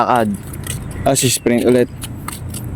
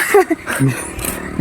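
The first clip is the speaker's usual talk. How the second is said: breathy laugh; 13 semitones higher, 6 dB quieter than usual. Real, not acted. Laughs after hitting a flying bug.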